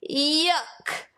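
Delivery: high, squawking disgust; disgusted seagulls. A narrator's reading. Seagulls tasting mustard sandwiches, high disgusted squawks. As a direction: spit it out like a gull.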